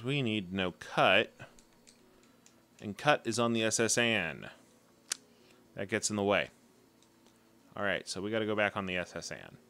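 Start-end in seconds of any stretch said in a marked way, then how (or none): none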